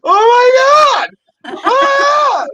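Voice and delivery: broken-up words bursting into a high scream; retold ecstatic shock. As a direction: pause between each word, then scream in mock amazement. Reaction to seeing billboard, acted.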